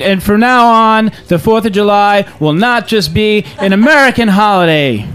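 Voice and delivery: rally the troops like a movie president; Bill Pullman's speech. Retold or imitated. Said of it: "Bill Pullman's speech in Independence Day".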